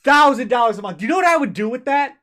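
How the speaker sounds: loud, indignant shout; furious outburst. Real, not acted. Streamer raging at chat over the dev's pay, loud. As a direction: hammer the figure, then rush the question. Ranting about dev's income.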